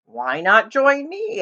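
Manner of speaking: put-on a cheerful old donkey calling out; friendly donkey. Donkey voice in the story.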